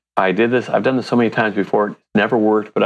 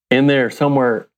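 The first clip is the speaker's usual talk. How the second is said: ordinary talk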